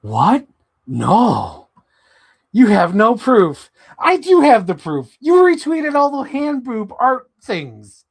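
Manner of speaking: loud, high, insisting on proof; mock accusation. Arguing with Captain Iron Dog about retweets, loud. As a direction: shout the proof at him.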